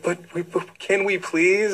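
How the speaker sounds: mock pleading. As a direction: beg like a sad patient who doesn't want to leave. Patients beg to keep seeing doctor.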